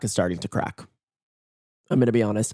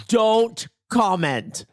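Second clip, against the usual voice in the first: high, drawn-out mock order; mock stern command; 8 semitones higher, much slower than usual. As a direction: stretch each word in a playful high-pitched warning.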